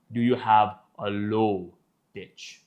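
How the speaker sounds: dropped, deep voice; low-pitched demonstration. Shown as a demonstration. Speech coach defining pitch, low and deep. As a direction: sink down low on the words.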